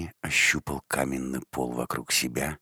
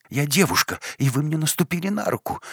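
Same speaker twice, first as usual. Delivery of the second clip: put-on much higher, fast, loud girl's complaint; high indignant squeal; 13 semitones higher, 5 dB louder, faster than usual. A narrator's reading. Girl squeals at man who stepped on her hand. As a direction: squeal out your hurt pride. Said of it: "Тот же визг".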